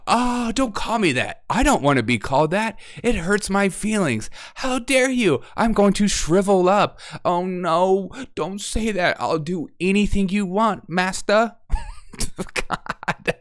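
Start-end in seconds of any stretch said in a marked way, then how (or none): 0.0-11.5 higher, whimpering mock victim; mock whiny victim. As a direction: whine it like a hurt snowflake.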